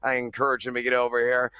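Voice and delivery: ordinary delivery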